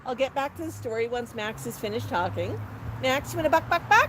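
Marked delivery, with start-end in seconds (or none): 3.0-4.1 high pet talk climbing into clucks; coaxing clucks. Pretend. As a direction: invite the bird to cluck back. Coaxing Max to cluck back.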